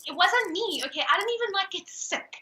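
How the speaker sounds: high-pitched mock defence; playful protest. Real, not acted.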